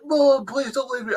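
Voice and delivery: high-pitched mock plea to a mother; imitated hurt plea. Fan quoting Ruby begging her mother to stay, imitated hurt. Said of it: "the hurt in her voice".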